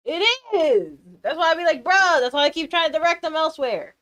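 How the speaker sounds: loud, high rant; worked-up loud complaining; exasperated. Real, not acted. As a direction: insist on it at full voice.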